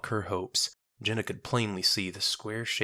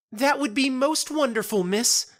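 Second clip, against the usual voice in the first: far higher and louder, bright and eager; childlike cheer; 7 dB louder than usual. Acted. Marifold cheerfully agreeing to Jenna's friend, childlike delight. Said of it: "with the earnest cheer of a child".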